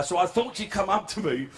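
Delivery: normal talking voice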